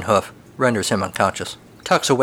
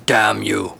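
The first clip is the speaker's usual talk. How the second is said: muttered curse; 2 semitones lower than usual. Acted. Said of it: "the dark horse mutters".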